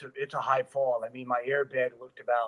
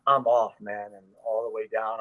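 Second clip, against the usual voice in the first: ordinary delivery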